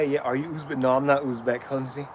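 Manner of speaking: normal talking voice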